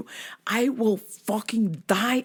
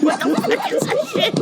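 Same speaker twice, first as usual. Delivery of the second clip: high yell through laughter; mock fury; laughing outraged yell; 4 dB louder than usual. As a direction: shriek it out in disbelief. Retold outrage.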